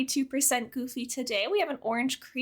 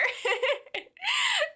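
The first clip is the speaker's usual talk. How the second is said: high shrieking laugh. Real, not acted. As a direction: let out a squealing laugh. Big laugh after her joke.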